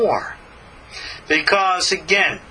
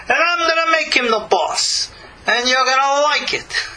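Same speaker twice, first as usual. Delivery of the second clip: hammed-up Sicilian Godfather voice; Sicilian Godfather accent; 9 semitones higher than usual. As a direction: play God as a Sicilian mafia don laying down the law. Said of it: "Sicilian accent here".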